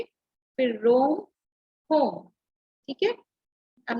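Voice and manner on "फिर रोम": रोम drawn out slowly; rhyming word demo. Shown as a demonstration. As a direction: stretch रोम to show its ending sound. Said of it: "ये दोनों rhyming words थे". (रोम को धीरे खींचकर बोलें)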